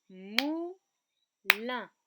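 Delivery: "moulin" loud, as mou, pause, lin; clapped-out syllables. Shown as a demonstration. Teacher claps out the word, each syllable loud and apart. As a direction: punch each separate half of "moulin". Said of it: "je tape des mains".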